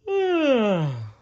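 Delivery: long high falling yawn-sigh; drowsy bear yawning sigh; sleepy yawn-sigh. Acted. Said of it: "I'm a bit sleepy".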